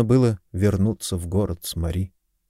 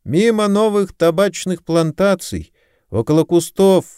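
high-pitched calling voice; acted shout in a raised, high voice; shouting back; 8 semitones higher than usual. A narrator's reading. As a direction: call directions back across a distance. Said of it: "закричала ему в ответ жена подмастерья".

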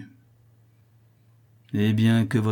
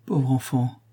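ordinary delivery, nothing marked

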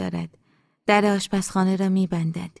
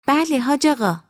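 high-pitched, eager servant's reply; housekeeper answering; 7 semitones higher, 5 dB louder than usual. Acted. Housekeeper answers the master's call, high and obliging. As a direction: answer high, falling away at the end.

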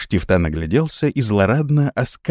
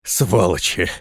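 tearful hoarse curse; 3 semitones lower, slower than usual. Acted. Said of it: "слёзно прохрипел Прокс".

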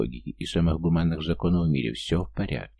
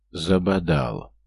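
slow drawn-out grumble; muttered through teeth; much slower than usual. A narrator's reading. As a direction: drag the word out in disgust. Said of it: "цедит Игорь Валерьяныч".

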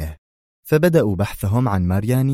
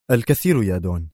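decisive. A narrator's reading. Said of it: "قال في حسم".

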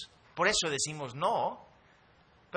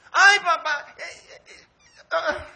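put-on high, loud, laughing little-girl begging; squealing child pleading for a toy; whiny little girl; 16 dB louder, slower than usual. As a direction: beg like a greedy child in the store. Daughter voiced whining.

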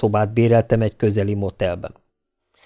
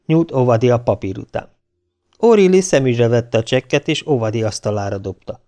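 ordinary delivery

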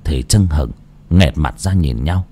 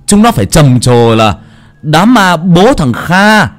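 boastful, rising higher and louder to the end; khoe khoang hăng hái; 8 semitones higher, 5 dB louder than usual. Acted. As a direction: brag it out to the whole village. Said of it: "khua chân múa tay". (con cả khoe đám ma to, giọng cao vống lên)

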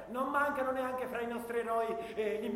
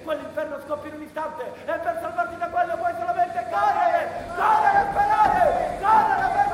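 rising from urgent to a full desperate yell; panicked war cry building up; building to a shout; 4 semitones higher, 9 dB louder than usual. Acted. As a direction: push it louder and higher until you're screaming.

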